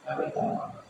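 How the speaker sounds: near-whispered, gentle; the companions reassuring Abu Bakar, barely whispered; soft reassurance. Retold or imitated. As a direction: hush it kindly. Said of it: "kata Suhaib".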